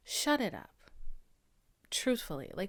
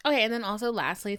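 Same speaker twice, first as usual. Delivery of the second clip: ordinary talk, nothing marked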